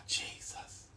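breathed "Jesus" under the breath; exasperated whisper. Real, not acted. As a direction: mutter it, fed up. Exasperated aside after sigh.

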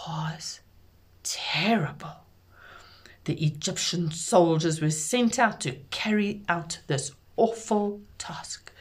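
hushed, grave whisper; whispered storytelling. A narrator's reading. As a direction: whisper it like a dark secret.

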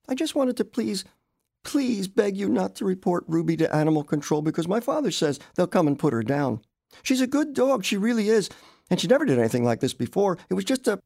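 put-on high-pitched young girl, begging; earnest child's plea that tumbles on; awkward pleading girl. A narrator's reading. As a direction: plead anxiously for the dog, pitched well up.

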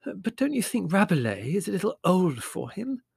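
high-pitched, hesitant motherly doubt; doubtful. Acted. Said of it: "asked Mother doubtfully".

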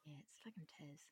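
hushed whispered aside. Real, not acted. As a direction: whisper it under your breath.

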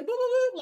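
loud, high-pitched "boop boop boop"; phone hang-up boops. Shown as a demonstration. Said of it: "I say that noise".

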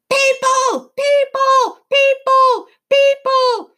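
very high, loud monotone screech; screaming creatures. A narrator's reading. Tree squeaks warning the forest, screaming. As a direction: screech each word like an alarm. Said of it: "they were screaming".

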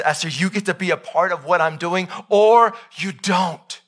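God's voice rising into a loud, booming warning; voicing God. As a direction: thunder it down as God laying out the choice. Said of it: "He says".

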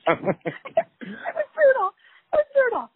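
high, squeaky words through laughter; real laughing fit, voice shooting way up; helpless laughter. Real, not acted. Laughing hard over painful memory.

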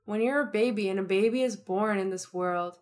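ordinary talk, nothing marked

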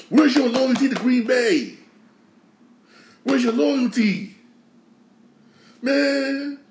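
wounded fan shouting at the departed player; exasperated outcry. Real, not acted. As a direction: call him out for betraying the team.

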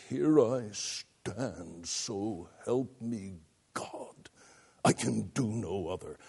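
slow, low and hushed solemn declaration; deliberate, held-back force on each word, kept soft; restrained intensity. Retold or imitated. Actor as Luther standing his ground, slow restrained intensity. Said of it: "Ictus, all the way through that".

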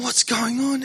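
high, alarmed cry of a question; panicked girlfriend. Retold or imitated. Girlfriend in the car watching her boyfriend get stopped, retold panicked cry. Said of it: "she was freaking out".

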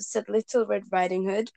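plain reading voice

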